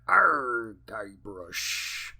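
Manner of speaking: low, gravelly LeChuck growl; deep pirate voice. Retold or imitated. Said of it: "such a distinct, deep voice".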